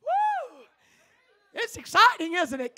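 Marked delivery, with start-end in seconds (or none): 0.0-0.6 a high, joyful whoop; high preacher's woo; excited whoop. Real, not acted. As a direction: whoop to fire up the church. Preacher whoops to rev up the crowd.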